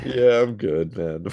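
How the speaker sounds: breathy after a laughing fit; after laughing hard. Real, not acted. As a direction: still winded from laughing. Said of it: "You good, buddy?".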